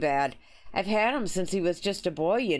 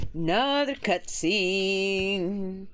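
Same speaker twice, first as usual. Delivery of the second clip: weary drawn-out repeat; much slower than usual. Real, not acted.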